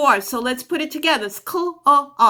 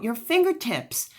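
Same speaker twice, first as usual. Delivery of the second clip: normal talking voice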